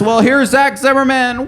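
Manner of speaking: high, loud letdown announcer. Retold or imitated. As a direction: announce it with a sag. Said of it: "the announcer's like".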